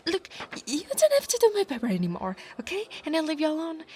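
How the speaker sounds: high, rapid, stammered offer; panicked bargaining. Acted. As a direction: blurt out a desperate deal. Panicked bargaining to stop reading.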